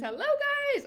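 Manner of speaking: very high sing-song hello; excited greeting. Real, not acted. Greeting viewers.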